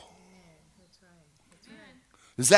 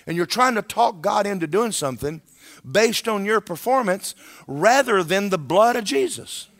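normal talking voice

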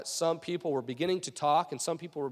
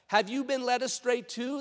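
higher-pitched mocking question; 6 semitones higher than usual. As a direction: taunt them like a scornful superior. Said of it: "the Pharisees mocked".

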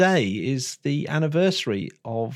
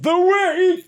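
announcer shout; 4 dB louder than usual. Acted. Announcing astronaut of the week.

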